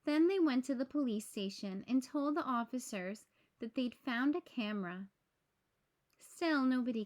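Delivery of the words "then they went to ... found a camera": ordinary delivery, nothing marked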